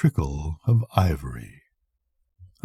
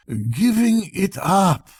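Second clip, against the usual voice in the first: tearfully; 9 semitones higher, slower than usual. Acted.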